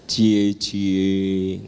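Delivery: lightly voiced jealous wife; a mock jealous little tease; faintly jealous cie cie. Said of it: "ada sedikit kecemburuan".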